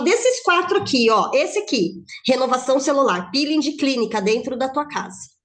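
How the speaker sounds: loud, punchy product pitch; emphatic sales pitch. Shown as a demonstration. Consultant showing a client the four products, loud emphatic pitch. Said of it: "a entonação que você fala".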